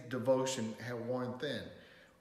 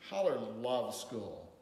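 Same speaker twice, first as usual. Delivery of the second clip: ordinary delivery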